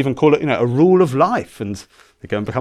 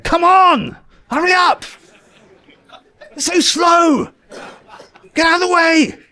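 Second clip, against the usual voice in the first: put-on high, loud, impatient shouting at a toddler; impatient yelling father; 7 dB louder than usual. Father yelling at his toddler on the stairs, impatient shout. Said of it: "Am I standing behind him saying".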